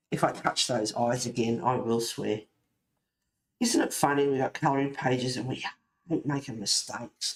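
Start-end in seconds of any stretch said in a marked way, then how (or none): none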